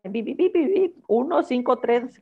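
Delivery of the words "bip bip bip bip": high, chirpy imitation of keypad tones; mock phone beeps. Telling a friend about the call center line, mock beeps.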